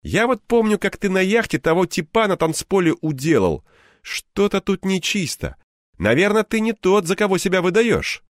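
high, cheerful buddy voice; a jolly friend brushing it off; friend Anton. A narrator's reading.

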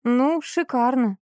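drawled outraged retort; indignant. A narrator's reading. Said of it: "возмущённо сплеснула руками".

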